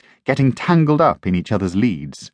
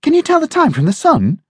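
high, loud wide-eyed puppy voice; impressed young puppy; 4 dB louder, faster than usual. Acted. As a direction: sound amazed by the clever friend. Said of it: "Bounce asked, impressed".

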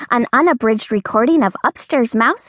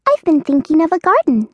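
mouse voice; 6 semitones higher, faster than usual. Acted. Mouse character voice.

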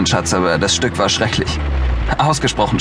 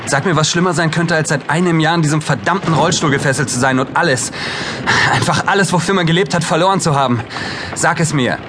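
raised, higher, bitter challenge; bitter outburst; 7 semitones higher than usual. Acted. Paul in a wheelchair lashes out at his brother, bitter and raised. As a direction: throw the pain at him.

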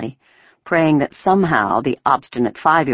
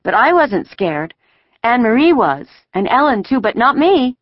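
put-on high, boastful child voice; chattering small child; 9 semitones higher, 5 dB louder than usual. A narrator's reading. Five-year-old boasting she wasn't scared, chattering. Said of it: "Kirsty was chattering".

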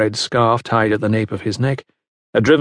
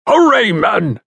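high, urgent shout; raised, carrying foreman's call; 10 semitones higher, 4 dB louder, slower than usual. A narrator's reading. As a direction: holler to hurry the workers along. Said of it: "called Bullymore".

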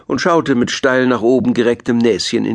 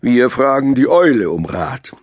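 slow, ponderous elk; deep-voiced elk; 2 semitones higher than usual. A narrator's reading. Elk suggests asking the owl, slow ponderous voice. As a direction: propose it slowly and weightily as the elk. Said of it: "mit seiner tiefen Stimme".

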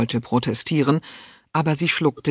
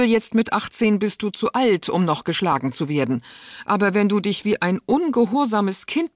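put-on higher, cold and commanding old duchess; stern aunt voice; 7 semitones higher than usual. A narrator's reading. Duchess threatening her niece Alida, cold and stern. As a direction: scold the girl from above.

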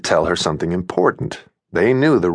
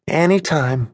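wry smile; 6 semitones higher, slower than usual. A narrator's reading. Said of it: "Fiona said with a wry smile".

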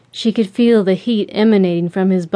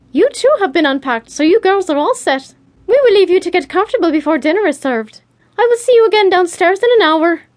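high, brisk character voice, getting quicker; chirpy hostess Bea; 9 semitones higher, 4 dB louder, faster than usual. Acted. Bea settles the girls in before dinner, high and brisk.

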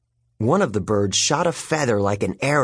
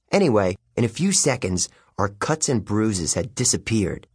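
usual speaking voice

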